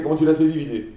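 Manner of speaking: usual speaking voice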